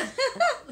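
high short laugh. Real, not acted. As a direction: laugh at her. Laughing at friend.

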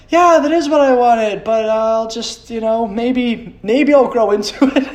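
high, slow, strained fake gratitude; forced brave face. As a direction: act pleased about clothes that do not fit. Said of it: "put on a brave face and be like".

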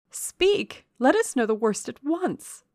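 high, loud, urgent plea; alarmed demand. A narrator's reading. Miss Ingram clutching Rochester for news, alarmed. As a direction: insist on an answer, pitch leaping up. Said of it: "said she".

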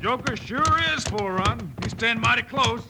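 high, slow excited call; excited race commentary. Acted. Bill in the horse race marveling at Joker, high and excited. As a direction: sound breathless at the close race.

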